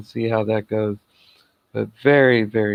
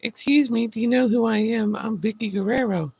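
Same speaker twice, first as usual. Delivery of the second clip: mock angry diva; 11 semitones higher than usual. Mocking Vicky Guerrero's angry rant in a high snooty voice. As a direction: ham up an offended diva. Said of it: "Vicky Guerrero was all pissed off".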